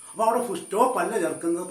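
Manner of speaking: ordinary talk, nothing marked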